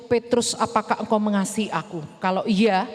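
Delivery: slightly deep gentle Jesus; lightly voiced Jesus. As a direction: ask earnestly as the teacher. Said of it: "tanya sama temanku Petrus".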